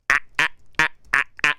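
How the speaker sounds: short, evenly spaced "ah" bursts; mock his mechanical laugh, beat by beat; mimicked staccato laugh. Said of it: "That's how he sounds".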